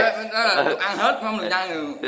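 high voice, laughing through words. Real, not acted. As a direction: talk while laughing. Laughing while explaining rules.

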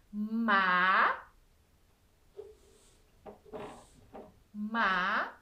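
"Ma" held long by itself, then repeated after a pause; stretched "Ma", a long gap, stretched "ma"; isolated syllable. Shown as a demonstration. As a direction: sound out "Ma" slowly as a lone syllable. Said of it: "outra sílaba".

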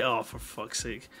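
ordinary talk, nothing marked

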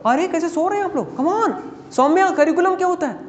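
high-pitched teacher's nudge to a silent class; exasperated prodding. Real, not acted. (सोती class को ऊँचे सुर में टोकना)

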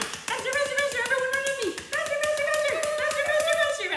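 very high, loud chant; frantic urging. Pretend. Fleeing Egypt with the children, frantic high urging. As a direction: squeal it in a breakneck rush.

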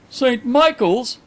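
high, slow, astonished echo. A narrator's reading. Visitor hears his old school named, astonished. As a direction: echo it in dawning recognition.

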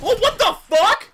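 high, loud disbelief; shocked outburst. Real, not acted. Player stunned at last kill, shocked outburst. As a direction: burst out in real shock.

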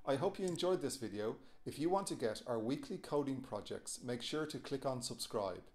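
upbeat channel outro; outro read. Acted.

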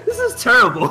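laughing through the words. Real, not acted. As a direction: crack up while saying it. Laughing at bad intro.

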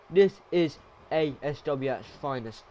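ordinary delivery

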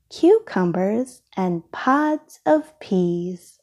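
ordinary delivery, nothing marked

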